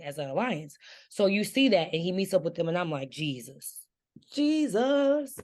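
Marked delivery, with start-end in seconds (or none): 3.1-3.8 low-key exclamation under the breath; hushed dismay. Retold or imitated. Speaker reacts twice to the story. 4.3-5.4 mock-shocked exclamation, voice way up; high-pitched dismay. Repeats the reaction, pitched far up.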